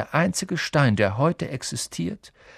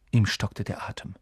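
plain reading voice

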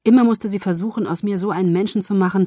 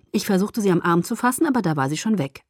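ordinary delivery

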